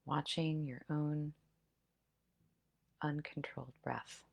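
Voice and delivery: soft, hushed meditation voice; guided breath meditation. Acted. Leads breath-counting meditation.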